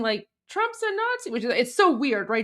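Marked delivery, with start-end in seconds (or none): 0.5-1.3 squeaky imitation of a protester yelling; a hammed-up, high-pitched yelled slogan; imitating a yell. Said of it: "starts yelling".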